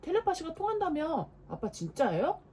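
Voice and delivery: high, loud petulant demands; irritable outburst. Acted. Little brother snapping at big brother at dinner, high and loud. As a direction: push back, annoyed and insistent. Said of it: "신경질을 냈어요".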